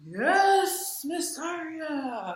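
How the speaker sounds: high, slow, stretched-out yes; exaggerated yes. Shown as a demonstration. Teacher showing students how not to nod, exaggerated high voice. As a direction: overdo it in a wobbly high voice. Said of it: "We don't go".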